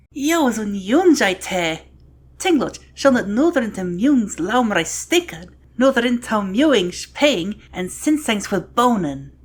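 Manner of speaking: loud, forceful declamation; dramatic recitation. Acted. Reciting the Yodean sample text, dramatic declamation. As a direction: declaim it slowly with heavy stresses.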